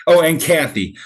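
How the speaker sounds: ordinary talk, nothing marked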